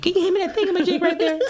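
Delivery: high, fast, loud, laughing; laughing mock request. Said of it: "you're like".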